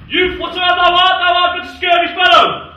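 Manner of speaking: bombastic reading. Acted. Sir Toby reads Sir Andrew's challenge aloud with mock bravado. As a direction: read the challenge like a blustering duelist.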